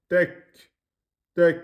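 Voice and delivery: "Tek" ending in an aspirated k; blocked, released k. Shown as a demonstration. Teacher repeating the kaf for learners, breathy release. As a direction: stop on the k of "Tek", then let breath out. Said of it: "On bloque, on lâche le, le souffle".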